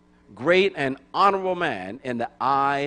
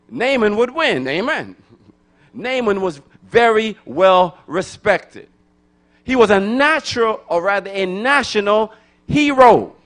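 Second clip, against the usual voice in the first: louder, higher preaching that builds; preaching at full force; 8 semitones higher, 8 dB louder than usual. Acted. Pastor stirring up the church about Naaman, preaching at full force. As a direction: rouse the congregation about their hero.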